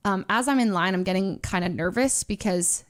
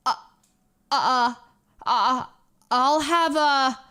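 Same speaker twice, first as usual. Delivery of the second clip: stuttering hesitation; slower than usual. Retold or imitated. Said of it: "he stutters".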